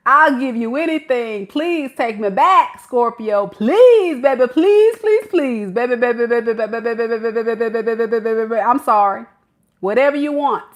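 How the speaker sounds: hammed-up begging to be taken back; desperate begging. Begging Scorpio to take them back, desperate pleading.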